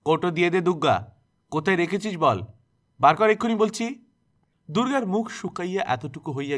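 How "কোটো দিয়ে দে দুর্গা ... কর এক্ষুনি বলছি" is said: sharp, raised and scolding, higher and louder; harsh tone. A narrator's reading. Aunt harshly demanding the box from Durga, raised scolding voice. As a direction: bark the demand at the girl. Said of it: "রুক্ষ সুরে দুর্গাকে বলিলেন".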